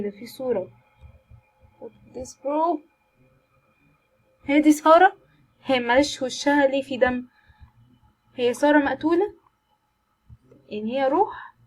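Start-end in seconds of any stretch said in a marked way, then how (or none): 4.4-7.3 loud, high startled question; loud shocked gasp of a question. Real, not acted. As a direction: blurt it out seeing the bloody photo. Shocked at picture of dead girl.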